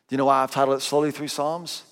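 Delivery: usual speaking voice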